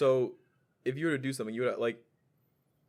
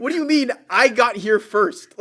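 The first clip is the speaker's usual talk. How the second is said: high, loud incredulous protest; exasperated disbelief; 9 semitones higher, 12 dB louder than usual. Retold or imitated. Arguing with father over the drawer, exasperated.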